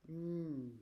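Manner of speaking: usual speaking voice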